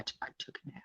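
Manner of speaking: hushed aside; whispering. Real, not acted. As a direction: whisper it quickly.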